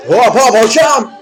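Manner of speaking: loud, slow call of hop hop hocam. Real, not acted. Calling out to stop the teacher, a slow loud shout.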